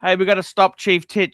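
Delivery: ordinary talk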